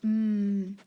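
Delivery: "Mm" as one sustained hum; held "mmm" with closed lips; M sound. Shown as a demonstration. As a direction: sound out "Mm" alone for spelling. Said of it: "so what do you hear?".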